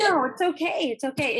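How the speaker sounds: brushing off the tears, emotional; teary "it's okay" with a laugh; choked-up reassurance. Real, not acted. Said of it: "I'm getting choked up".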